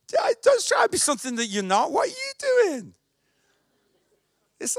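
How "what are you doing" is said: high, slow scoffing question; mock the critic with a high sneer. Pastor mocking a discouraging friend, high scoffing voice.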